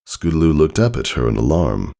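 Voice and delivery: ordinary delivery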